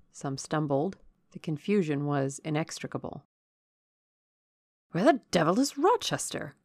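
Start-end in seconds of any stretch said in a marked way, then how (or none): 4.9-6.6 higher-pitched, exasperated call; agitated cry. A narrator's reading. Colonel Dent hunting for Rochester in the confusion, agitated cry. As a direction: demand it in alarm, pitch jumping up. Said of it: "cried Colonel Dent".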